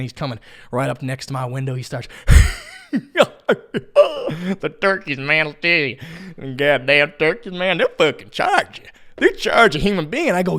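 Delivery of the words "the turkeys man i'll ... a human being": farmer laughing. Retold or imitated. Old farmer at car window warns of charging turkeys, laughing. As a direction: imitate a farmer talking through laughter. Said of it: "So he's laughing as he rounds the bend".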